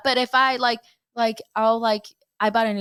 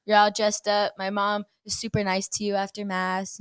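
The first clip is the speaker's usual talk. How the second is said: ordinary talk, nothing marked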